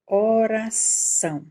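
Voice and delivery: "Oração" slow and loud, weight on -ção; final syllable stressed. Shown as a demonstration. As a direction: stretch "Oração" and hit the ending. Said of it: "também tá aqui no final".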